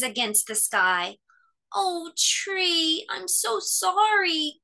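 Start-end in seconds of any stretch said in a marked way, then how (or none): 1.7-4.6 high, slow sorrowful apology; gulped apology. A narrator's reading. Cub apologises to his bare tree, sorrowful. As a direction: swallow hard and say sorry. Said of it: "gulped Fletcher".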